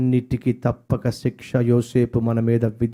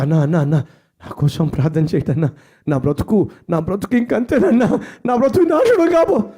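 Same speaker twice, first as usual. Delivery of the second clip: imitating crying brother; 5 semitones higher than usual. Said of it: "ఏడ్చేస్తున్నాడు".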